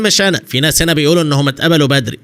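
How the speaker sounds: usual speaking voice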